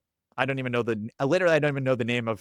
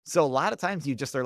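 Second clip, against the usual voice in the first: ordinary talk, nothing marked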